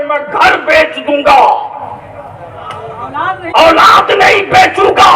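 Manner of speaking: mad man's defiant shout, high and loud; fiery preacher voicing a madman's vow; shouted quote. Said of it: "पागल भी होता है तो कहता है".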